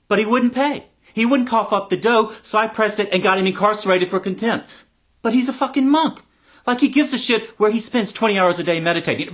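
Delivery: high, loud exasperated complaint; indignant rant. Acted. Litigant complaining the monk wouldn't pay, indignant rant. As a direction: pitch it up and rail at the injustice. Ranting about suing the monk.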